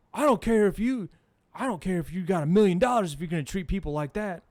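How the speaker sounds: loud, indignant mock rant; angry stranger. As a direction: imitate a man mouthing off as he leaves. Mimics angry beggar mouthing off.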